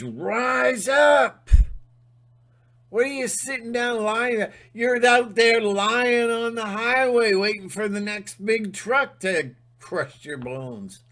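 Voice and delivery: high, loud rallying cry to the viewers; rallying shout. Real, not acted. Vlogger shouting at viewers to rise up, rallying. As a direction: yell them up off the floor.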